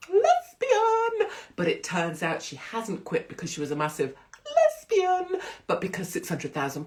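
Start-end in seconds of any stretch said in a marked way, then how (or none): 0.1-1.3 hammed-up high-pitched holler; mock-scandalised outburst. Mocking the word each time. 4.5-5.4 hammed-up high-pitched holler; mock-scandalised outburst. Mocking the word each time.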